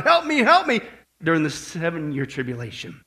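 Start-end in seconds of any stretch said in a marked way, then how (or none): 0.0-0.8 high mock plea for rescue; crying out. Whole planet doing the same thing, imitated crying out. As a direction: imitate a cry for help. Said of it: "literally crying out".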